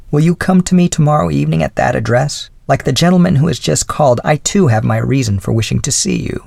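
a hint of the old widow's voice; elderly lady voice. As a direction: speak as a kindly older woman. Mrs Callender's invitation.

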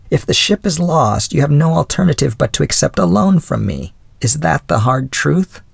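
a hint of the old widow's voice; elderly lady voice. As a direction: speak as a kindly older woman. Mrs Callender sums up.

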